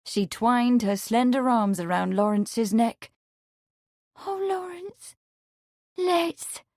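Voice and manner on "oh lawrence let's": high, soft, passionate plea; passionate whisper. A narrator's reading. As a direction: breathe it into his chest. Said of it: "she whispered passionately".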